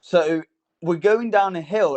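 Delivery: usual speaking voice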